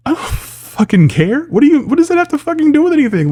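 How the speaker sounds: real exasperation, voice shooting way up high; exasperated. Real, not acted. Vlogger snapping back at fans' excuses, exasperated. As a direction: squawk it out in high-pitched disbelief.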